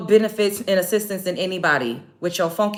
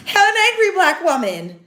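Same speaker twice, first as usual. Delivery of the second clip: mocking drawn-out voice; 8 semitones higher, 4 dB louder, slower than usual. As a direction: stretch it out in mockery. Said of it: "y'all say".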